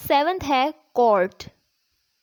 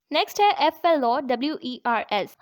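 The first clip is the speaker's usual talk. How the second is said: ordinary talk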